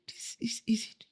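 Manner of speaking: breathless quick whisper; whispered aside. Retold or imitated. Daughter whispering to her friend, hushed and quick. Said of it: "she said".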